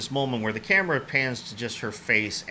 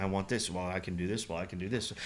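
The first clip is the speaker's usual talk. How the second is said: lower, softer flat deadpan exchange; dead-eyed monotone; 6 semitones lower, 10 dB quieter than usual. Retold or imitated. Diner and chef in a dead-eyed monotone exchange. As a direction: drone it with no life at all. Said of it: "dead-eyed monotone conversation".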